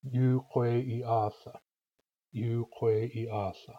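Yu kwe slow and held, i brief; long-long-short-long-short word rhythm. Shown as a demonstration. Teacher putting sounds back into the rhythm, slow measured syllables. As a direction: stretch Yu, kwe and the start of atha. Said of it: "put the vowels and consonants back in".

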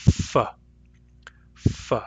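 F and f as a lip-on-teeth hiss; F sound demo. Shown as a demonstration. Teacher repeating the f spirant for students, hissed. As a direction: isolate the f hiss twice. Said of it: "The F sound is a spirant".

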